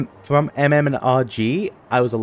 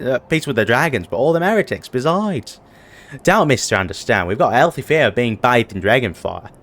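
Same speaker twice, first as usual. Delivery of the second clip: put-on a light British accent, a touch louder; 4 dB louder than usual. Soldier chatting to the hero, light British accent. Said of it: "are you doing a British accent now?".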